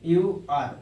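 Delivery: letter by letter, very slow; slow, deliberate spelling; spelled out. Shown as a demonstration. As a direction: spell it out slowly for dictation. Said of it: "इसका pronunciation क्या हो जाएगा?".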